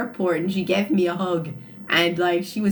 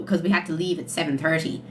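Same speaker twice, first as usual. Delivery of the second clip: ordinary talk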